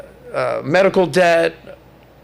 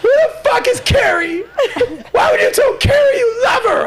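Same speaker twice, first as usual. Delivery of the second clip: much higher and louder, shrieking accusation; mock screaming girlfriend; 3 dB louder than usual. Man imitating his ex accusing him about Carrie, high mock shriek. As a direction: scream it like a jealous hysterical ex. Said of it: "She's just like".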